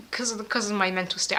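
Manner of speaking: usual speaking voice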